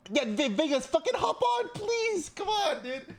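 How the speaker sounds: very high, loud whiny begging; hammed-up desperate squeal; pleading yell. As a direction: beg a friend at the top of your voice. Pleads with friend to join.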